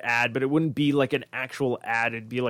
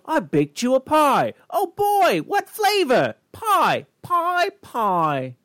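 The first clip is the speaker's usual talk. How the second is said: much higher squeaky cartoon character; silly cartoon voices; 11 semitones higher, 4 dB louder, much slower than usual. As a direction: ham up a goofy cartoon kid asking about pie.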